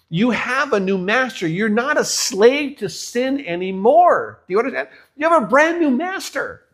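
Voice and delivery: high, loud pulpit climax, pressing each question; full-force preaching. Acted. Preacher pleading with the congregation about sin, full-force preaching. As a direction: drive it home like a revival preacher.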